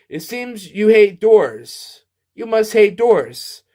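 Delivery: slow and pointed, every word weighted; with emphasis. Shown as a demonstration. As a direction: spell it out slowly for emphasis. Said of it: "I had to say that for emphasis".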